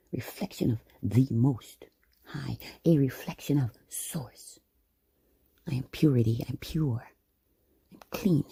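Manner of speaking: usual speaking voice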